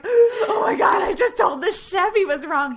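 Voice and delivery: high, loud, laughing mock panic; hammed-up alarmed outburst. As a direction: gasp and blurt it out in pretend horror. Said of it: "I got all tense".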